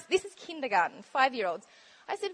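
normal talking voice

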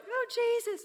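high, loud, breaking cry of Oh, Jesus; mock the preacher crying out to Jesus; imitating her cry. Preacher imitating Heidi's emotional cry: high loud. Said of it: "all she can do is go".

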